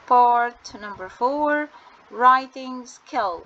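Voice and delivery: each English word drawn out very slowly; slow heading read. Shown as a demonstration. Teacher announcing the next section to students, very slow and deliberate.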